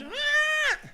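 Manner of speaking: put-on high, held baby squeal, kept soft; imitated baby scream. Preacher imitates a baby wanting its bottle, mock scream. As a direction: mimic a screaming baby without full volume. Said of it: "el bebé empieza a gritar".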